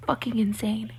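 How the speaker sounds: soft, unvoiced disgusted whisper; muttered whisper of disbelief; whispered aside. Real, not acted. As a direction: breathe it out under your breath. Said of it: "whispered aside".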